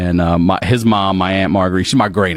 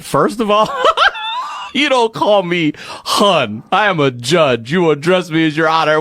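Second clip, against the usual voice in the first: higher, mock-indignant judge laughing through it; stern judge; 9 semitones higher than usual. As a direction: scold like an offended judge, half laughing. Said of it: "That lady said".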